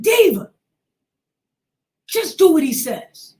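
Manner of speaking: high, loud scolding; a hint of a stern mother. Mother telling her daughter to obey, high loud scolding.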